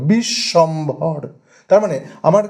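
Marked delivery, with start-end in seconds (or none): 0.1-1.2 "Bishwambhar" slow, broken bish, shom, bhor; three stressed beats. Shown as a demonstration. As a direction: stress and separate each syllable of "Bishwambhar". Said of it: "তিনবার আমাকে কিন্তু থামতে হলো". ("বিশ্বম্ভর" তিন দলে জোর দিয়ে, ধীরে)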